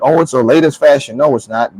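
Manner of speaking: ordinary talk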